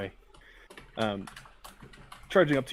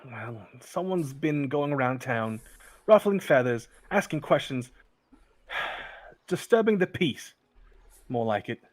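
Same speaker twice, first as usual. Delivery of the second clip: louder, higher, annoyed complaint; irritated constable; 5 semitones higher, 7 dB louder than usual. Acted. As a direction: list the offenses with mounting irritation.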